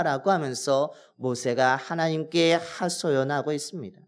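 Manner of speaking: usual speaking voice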